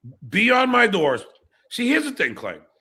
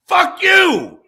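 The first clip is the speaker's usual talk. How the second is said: high, loud outburst; angry shout; 9 semitones higher, 7 dB louder than usual. Real, not acted. Streamer defending himself over the DoorDasher, shouting angrily. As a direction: fire it back at the critics. Answering imagined critics of him.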